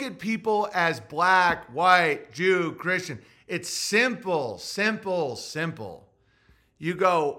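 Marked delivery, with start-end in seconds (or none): none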